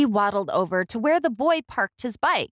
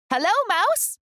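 much higher, bright little duck greeting; cheerful duck voice; 12 semitones higher than usual. A narrator's reading. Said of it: "said Duck".

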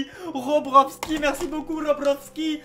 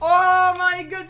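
stretched very slow, higher and louder; drawn-out oh my god; 6 semitones higher, 9 dB louder, much slower than usual. Real, not acted. Brother reacting to the kill with his favourite weapon, drawn-out shock. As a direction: drag out the shock.

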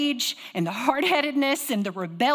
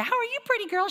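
much higher sing-song greeting; sweet lady voice; 13 semitones higher than usual. Retold or imitated. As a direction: coo it like a doting stranger. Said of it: "she said".

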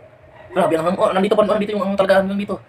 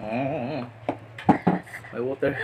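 normal talking voice

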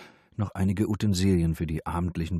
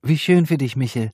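louder, higher, forced brightness; high, loud and pointed; 7 semitones higher, 7 dB louder than usual. A narrator's reading. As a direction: say it loud for the room to hear. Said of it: "Laut sagte sie".

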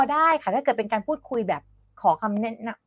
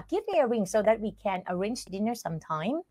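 quicker, softer take without breaks between phrases; quick connected reading; 6 dB quieter, much slower than usual. Shown as a demonstration. Teacher reading the whole example sentence for students, quick and connected. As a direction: run the whole sentence together in one breath. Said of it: "เอาพูดเร็วไป".

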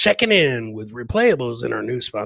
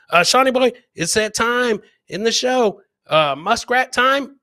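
hype call; 7 semitones higher, 6 dB louder than usual. Real, not acted. Hyping up the next segment.

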